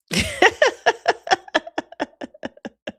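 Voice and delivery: long, high staccato laugh. Real, not acted. Host laughs at "very tactful", long high staccato laugh. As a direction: crack up in a high, rapid-fire cackle.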